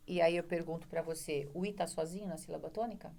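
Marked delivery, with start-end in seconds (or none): none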